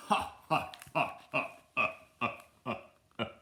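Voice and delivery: hammed-up villain cackle tumbling down in pitch; fake, evenly punched evil laughter.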